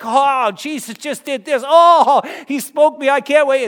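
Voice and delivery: giddy gush with a laugh; giddy excitement. Retold or imitated. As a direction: gush like a new believer. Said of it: "you're like".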